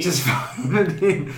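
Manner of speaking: slow, laughing through the words. Real, not acted. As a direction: chuckle it out slowly. Laughing while repeating the joke.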